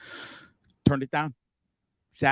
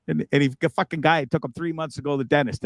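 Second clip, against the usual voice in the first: ordinary talk, nothing marked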